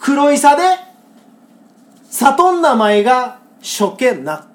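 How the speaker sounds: slow, deliberate Kagoshima dialect reading of the senryu; Kagoshima dialect recital. Shown as a demonstration. Host reciting a Kagoshima senryu to co-host, slow Kagoshima dialect. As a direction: savour each Kagoshima word slowly.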